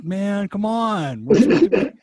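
put-on whiny punk kid. Punk kid whining at his kind mom, mock whiny. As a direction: complain like a spoiled punk kid. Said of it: "It's like".

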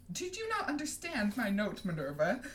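high, sugary question; falsely sweet and affected; honeyed. A narrator's reading. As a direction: ask in honeyed tones, starting high. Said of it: "in honeyed tones".

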